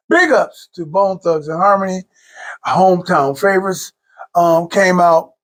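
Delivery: ordinary delivery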